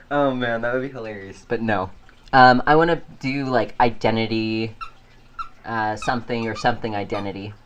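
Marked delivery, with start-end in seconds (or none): none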